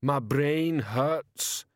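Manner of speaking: slow, heavy troll voice; slow dazed troll. Acted. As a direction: drag it out like a groggy troll. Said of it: "he muttered".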